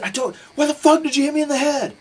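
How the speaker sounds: high, very loud outraged demand; enraged bellowed question; furious shouting. Retold or imitated. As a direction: yell it in a full imitation rage. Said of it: "he was just furious about everything".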